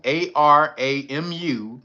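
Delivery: slow, clear spelling of "A R A M U". Shown as a demonstration. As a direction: spell "A R A M U" slowly, letter by letter. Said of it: "that's Aramu".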